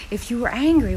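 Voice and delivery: ordinary talk, nothing marked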